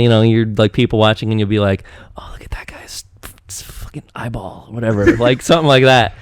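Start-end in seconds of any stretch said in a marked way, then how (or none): 2.2-4.7 hushed, voiceless aside; whisper. Retold or imitated. Husband to wife about people watching, secretive whisper. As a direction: whisper it so nobody watching hears. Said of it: "whisper something to Kayla".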